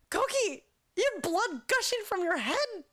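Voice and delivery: high, exclaiming disbelief; incredulous. Retold or imitated. Director answers injured dancer's apology, retold incredulity. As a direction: re-enact exasperated disbelief, pitched well up.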